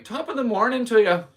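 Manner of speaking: high, loud mock-Irish holler; yelling. Drunk tourist in Dublin to moms, mock yelling. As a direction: holler it out as a boisterous fake-Irish greeting.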